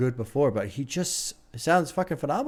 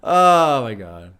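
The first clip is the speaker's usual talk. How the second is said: a loud, slow, stretched-out gasp of awe; amazed outburst; 9 dB louder, slower than usual. Real, not acted. Reviewer hit by the beat drop, loud slow awe. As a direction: blown away by the track, let it out.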